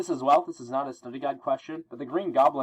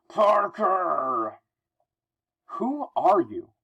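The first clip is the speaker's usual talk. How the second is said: Green Goblin; 6 semitones higher, much slower than usual. Acted. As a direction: call out Parker's name as a menacing goblin. Voicing the Goblin confronting Spidey.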